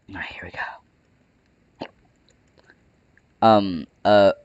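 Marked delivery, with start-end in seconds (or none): 0.1-1.9 whispering. Real, not acted. Unvoiced whisper before run.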